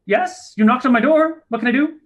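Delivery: high, squeaky little gnome greeting; tiny gnome voice. Acted. Tiny gnome answering a goblin's knock, high and squeaky. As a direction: pipe up like the tiniest gnome.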